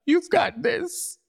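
high, drawn-out self-encouragement; mirror pep talk. Shown as a demonstration. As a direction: lift it way up and cheer yourself on slowly.

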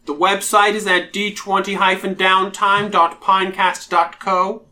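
imperious British drow priestess. Pretend. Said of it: "how all of the Imperious Drow priestesses will speak".

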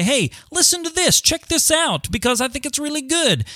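high-pitched voice of a fan sharing a find; enthusiastic listener's recommendation. Acted. Host voicing followers sharing the podcast, high-pitched eager plug. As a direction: pitch it way up, as if urging friends.

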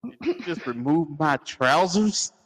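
slow, scandalized southern belle. Acted. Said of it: "Southern belle".

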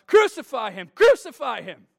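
loud, high shout of a mob; crowd shout. Retold or imitated. Crowd in the courtroom condemning Jesus, shouting loudly. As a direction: shout it like an angry crowd, punching the first word. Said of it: "will shout".

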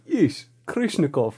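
put-on slow Eastern European film-character accent; mock Eastern European accent. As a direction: imitate a thick Eastern European accent, slowly. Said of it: "he does the accent".

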